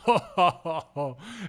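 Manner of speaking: hearty acted ho-ho-ho; spirit's laughter. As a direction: laugh down at them from the mountainside. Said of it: "sitting up on the side of the mountain laughing".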